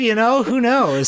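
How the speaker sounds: high, loud laid-back hippie shrug. Retold or imitated. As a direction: toss it off like a carefree hippie. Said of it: "he seems like a hippie".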